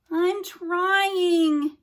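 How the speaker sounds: put-on high, loud, near-crying girl; strained cry. A narrator's reading. Haley answers her ancestor, high and loud. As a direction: push it out like she's overwhelmed.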